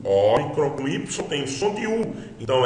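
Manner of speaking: usual speaking voice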